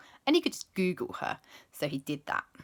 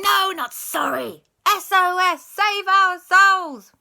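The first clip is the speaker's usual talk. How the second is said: much higher, louder ghostly wail, slowing at the end; pleading ghosts; 13 semitones higher, 11 dB louder, slower than usual. Acted. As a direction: cry out like desperate little ghosts.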